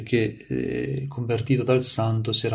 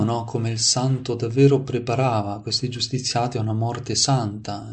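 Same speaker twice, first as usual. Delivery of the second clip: normal talking voice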